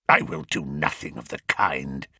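low, growled refusal; angry old visitor, pitched low; menacing old man. A narrator's reading. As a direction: refuse in a deep, threatening voice. Enraged visitor refuses Holmes.

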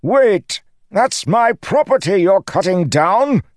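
high, indignant distant shout; calling from afar. A narrator's reading. Slave owner to the archers in the distance, outraged call. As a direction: yell in protest across a distance. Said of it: "In the distance, a voice called".

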